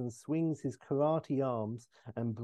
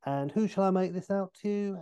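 higher-pitched, breezy signing patter; Austin Powers; 6 semitones higher than usual. Acted. Austin to a fan at a book signing, chirpy impression. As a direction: play him higher and chatty. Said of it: "and Austin being like".

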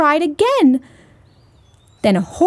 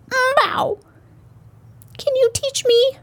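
very high little kitten plea; 13 semitones higher than usual. Acted. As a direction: plead like a tiny kitten. Kitten pleads to baby.

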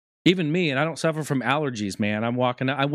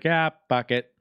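groundhog voice; 6 semitones higher, slower than usual. Retold or imitated. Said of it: "he's like".

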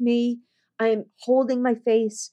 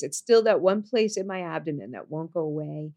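ordinary talk

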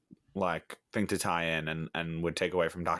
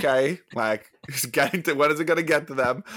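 higher, louder exasperated retelling; mock impatience; 6 semitones higher, 6 dB louder than usual. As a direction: recount your impatience, pitch and volume lifted. Joking impatience at slow scene.

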